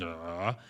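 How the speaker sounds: low, quiet groggy groan; morning groan. Shown as a demonstration. Coach showing their waking-up self, groggy groan. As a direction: groan as if just woken up. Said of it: "When I wake up in the morning".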